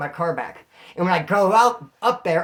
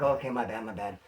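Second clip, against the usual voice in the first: meek apology; 7 semitones lower, 6 dB quieter than usual. Retold or imitated. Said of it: "I was like".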